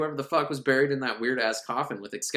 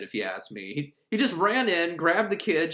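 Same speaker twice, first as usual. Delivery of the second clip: ordinary talk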